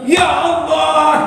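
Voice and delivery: high, drawn-out exclamation; astonished doctor. Acted. Doctor learning whose sample it was, astonished. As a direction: stretch the cry in mock astonishment. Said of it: "katanya dokter".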